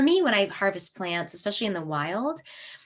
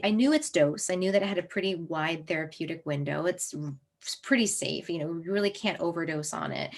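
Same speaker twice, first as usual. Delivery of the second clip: usual speaking voice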